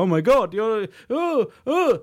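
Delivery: squealing, rising fake fright; hammed-up scared yelp; mock panic. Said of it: "I'm gonna go".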